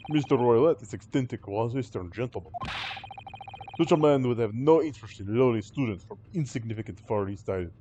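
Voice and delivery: put-on disdainful Russian accent; scornful Russian sailor. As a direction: look down on them like a Russian crewman. Sailor insults the students.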